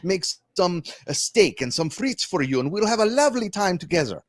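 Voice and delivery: hammy French chef inviting you to dinner; French accent. Shown as a demonstration. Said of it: "to fake a French accent".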